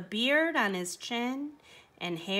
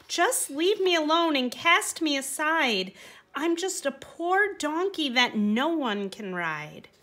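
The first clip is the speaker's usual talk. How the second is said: mild sad plea; 6 semitones higher, 3 dB louder than usual. A narrator's reading. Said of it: "the sad donkey cried".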